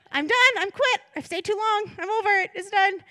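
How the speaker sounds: high, loud mock meltdown; dramatic re-enacted quitting rant; exasperated outburst. Retelling own outburst to pastor.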